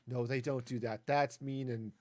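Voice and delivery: usual speaking voice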